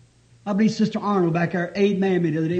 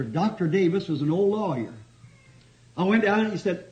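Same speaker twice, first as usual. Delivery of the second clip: ordinary talk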